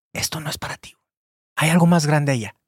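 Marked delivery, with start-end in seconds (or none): 0.1-1.0 soft unvoiced whisper. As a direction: whisper it like a secret. Whispered advice to the seller.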